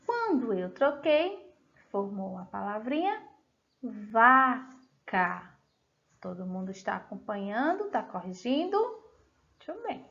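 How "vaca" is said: "vaca" slowly split as va, ca; slow dictation of "vaca" with a gap inside; syllable by syllable. Shown as a demonstration. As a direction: separate both syllables of "vaca". Teacher sounding out changed word.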